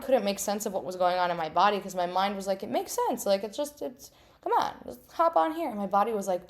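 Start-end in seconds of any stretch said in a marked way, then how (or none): 2.6-5.7 coaxing inner voice. Retold or imitated. Mind coaxing her onward.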